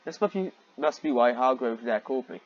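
normal talking voice

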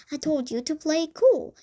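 mock the heartbroken cousin; sneer. A narrator's reading. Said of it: "he sneered".

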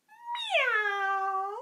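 high playful meow; cat meow. Shown as a demonstration. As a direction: meow like a kitty. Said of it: "Let's do their sounds".

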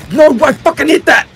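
loud, high angry yell; frustrated shout. Real, not acted. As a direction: yell it out in disbelief. Frustrated shout at a missed hit.

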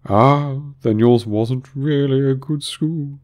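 high, drawn-out relief; greatly relieved. A narrator's reading. Mock Turtle judging Alice's school, greatly relieved. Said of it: "in a tone of great relief".

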